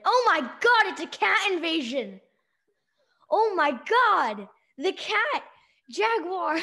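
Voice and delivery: high, loud and slow "oh my God"; mock panic. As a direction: shriek about the cat invasion. Panicked cat invasion game.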